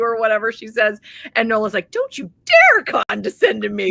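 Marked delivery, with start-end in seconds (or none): 1.9-3.9 indignant, louder, sharp; mock-indignant snap; angry retort. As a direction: throw it back at her, offended. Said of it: "even more angry".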